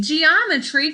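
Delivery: normal talking voice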